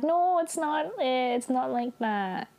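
high whine sinking as it goes, imitating someone in tears; mock crying. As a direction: whimper it in a put-on cry. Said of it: "she's just, like, crying harder".

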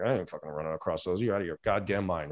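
low, very quiet grumble to self; hushed, low-pitched disbelief; balking. Acted. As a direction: refuse under your breath, quickly. Acted doubter at the coals.

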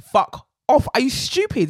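loud, high "Fuck off" thrown back at him; mock-furious telling-off, loud and high; retold angry yell. Speaker retells telling him off.

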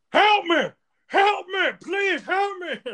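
high-pitched mock screaming; mock cries for help. Joe calling from a cell, mock desperate cries. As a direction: imitate a desperate plea, slow and very high.

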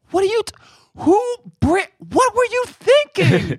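high, stretched out exasperated what were you thinking; incredulous scolding. Retold or imitated. J B Smoove to friend who brought her, high incredulous scolding.

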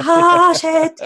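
mock-horrified yelp, high and loud; dismayed outburst.

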